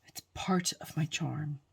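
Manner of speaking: whispered joking aside; whispering. Real, not acted. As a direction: whisper it like a secret. Self-mocking aside after blunder.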